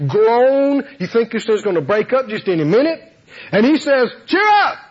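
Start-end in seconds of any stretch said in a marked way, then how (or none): 4.3-4.8 high-pitched exhortation to the crew; mock-rousing shout of encouragement; cheery call. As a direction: call out a chirpy order to cheer up. Acts preacher calling to storm-tossed crew.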